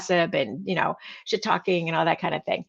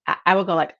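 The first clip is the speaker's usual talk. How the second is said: normal talking voice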